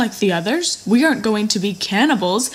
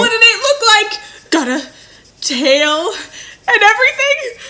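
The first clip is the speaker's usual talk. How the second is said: giddy laughing; 12 semitones higher than usual. Acted. Giddy at news of horse.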